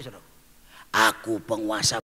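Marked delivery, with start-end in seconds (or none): 1.1-2.0 put-on much lower, gruff man's voice from a girl; demon's male voice. Spirit speaking through the girl, deep male voice. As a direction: speak as the possessing spirit, low and menacing. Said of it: "Suaranya jadi suara laki-laki".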